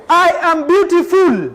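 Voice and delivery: cocky chanted affirmation; with a bit of swag. Shown as a demonstration. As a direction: belt it out with a little swagger. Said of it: "na kaswag kidogo".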